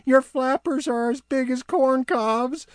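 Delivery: high teasing elf. Acted. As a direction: poke fun, high and light. Barnaby teasing.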